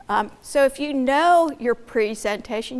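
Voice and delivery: ordinary talk, nothing marked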